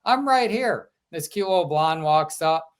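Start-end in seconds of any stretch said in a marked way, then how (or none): 0.0-0.9 higher-pitched imitation Southern drawl. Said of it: "in her Southern drawl".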